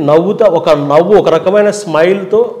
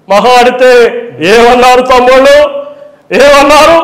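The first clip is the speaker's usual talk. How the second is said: much higher, loud, rising public-meeting voice; Chandrababu rally; 10 semitones higher, 5 dB louder than usual. Retold or imitated. Chandrababu Naidu impression stirring brothers at a rally. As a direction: whip up the crowd, then drop. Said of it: "బహిరంగ సభల్లో మాట్లాడినప్పుడు".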